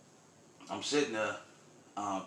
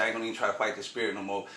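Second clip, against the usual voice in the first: rushed quote; faster than usual. Retold or imitated. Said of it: "She said".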